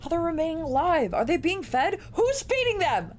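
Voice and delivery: exasperated. Real, not acted. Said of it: "I'm so mad".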